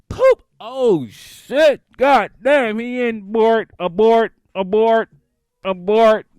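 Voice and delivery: loud, high emergency shouts; hammed-up panicked yelling; panicked alarm. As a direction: call abort like a siren, in bursts. Acts panic as toddler opens the door.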